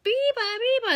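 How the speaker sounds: high chirpy bird call; high mimicked birdsong; bird call imitation. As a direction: sing it like a little bird. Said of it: "one bird that go".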